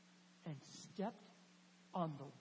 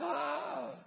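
loud, high gasp of alarm; mock crowd gasp; imitated gasp; 6 semitones higher, 5 dB louder than usual. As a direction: gasp aloud like shocked onlookers. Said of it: "hears the gasp of the apostles and the disciples".

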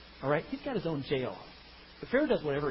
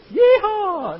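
much higher, much louder hammed-up whoop; mock yeehaw; 14 semitones higher, 12 dB louder than usual. Hillbilly brothers whooping at Pharaoh, high loud mock whoop. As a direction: whoop it like a rowdy cowboy.

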